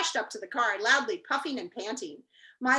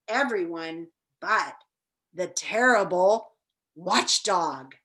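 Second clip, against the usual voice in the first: slow, low, ominous warning; 5 semitones lower, 4 dB louder, slower than usual. Acted. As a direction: drag it out like a scary secret. Ominous mention of watchdog.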